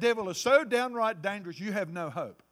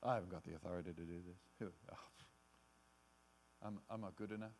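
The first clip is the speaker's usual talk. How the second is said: much lower and softer, faltering to almost nothing; self-doubting; 10 semitones lower, 15 dB quieter, faster than usual. Pretend. Believer muttering to themselves after the devil's accusations. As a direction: talk yourself down into defeat. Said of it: "you're saying to yourself".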